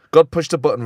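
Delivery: usual speaking voice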